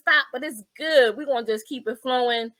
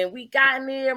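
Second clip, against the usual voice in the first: normal talking voice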